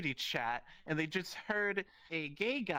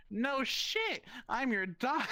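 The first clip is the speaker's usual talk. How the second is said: sassy comeback; 8 semitones higher, slower than usual. Retold or imitated. Punchline reply to dad.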